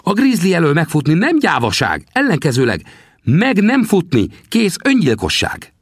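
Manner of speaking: put-on high, teasing little old man's voice; old trapper Sam. Sam teasingly asks the narrator about his bones, high old voice. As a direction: needle the greenhorn from a high perch. Sam's voice in dialogue.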